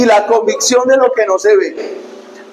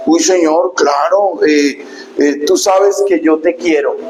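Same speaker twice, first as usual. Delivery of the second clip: lower, faltering, sheepish answer; acted uneasy disciple dodging the question; hesitant reply; 5 semitones lower than usual. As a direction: hedge awkwardly with little stammers. Peter answers evasively, stammering.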